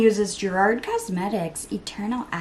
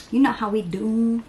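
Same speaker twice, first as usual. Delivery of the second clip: ordinary talk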